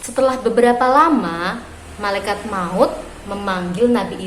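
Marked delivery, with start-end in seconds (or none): none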